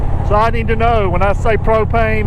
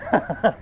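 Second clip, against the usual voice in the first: short real laugh; a quick chuckle at the answer; amused laugh; 3 semitones lower, 8 dB quieter than usual. Real, not acted.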